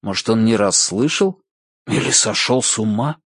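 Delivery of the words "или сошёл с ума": ordinary delivery, nothing marked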